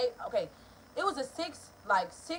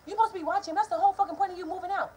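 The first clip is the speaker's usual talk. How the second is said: high, insistent scolding; angry mother; 8 semitones higher, 3 dB louder, faster than usual. Retold or imitated. Mother insisting the daughter should watch him, high scolding. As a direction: scold her like a furious mom.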